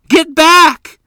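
high, loud shrill cry of warning. Acted. Mother warning the children from the snake, shrill shout. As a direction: shout the children back from danger. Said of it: "Mother warned, her voice shrill".

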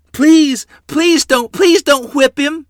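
desperate, very high and loud begging; screamed plea. A narrator's reading. Lottie begs father to spare brother, screaming. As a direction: scream it like a terrified child. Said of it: "Lottie screamed".

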